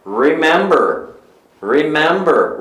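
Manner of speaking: slow "remember" with a held English R; "rrremember", drawn out as a model; dog-like English R. Shown as a demonstration. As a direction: growl into the R of "remember". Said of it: "tem um som meio que de cachorro".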